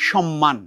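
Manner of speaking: সম্মান as "shom-man", like amma; doubled m. Shown as a demonstration. Teacher corrects pupils' সম্মান to "shom-man", double m. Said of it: "এটাও ঠিক তেমনি".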